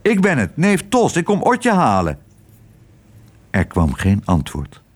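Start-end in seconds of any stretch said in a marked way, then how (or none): 0.0-2.2 high-pitched call back upstairs; raised, higher voice answering. A narrator's reading. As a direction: call up to announce yourself.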